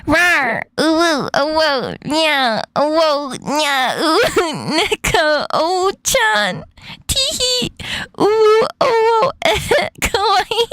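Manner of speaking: high-pitched cutesy uwu voice, climbing as it goes; normal uwu voice. Shown as a demonstration. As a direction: squeak out each cute word, pitch rising into giggles. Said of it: "there's a normal uwu voice?".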